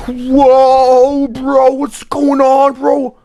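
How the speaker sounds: high, drawn-out disbelief; stunned. Real, not acted. Streamer reacting to news from the chat, stunned. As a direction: stretch it out high in amazement.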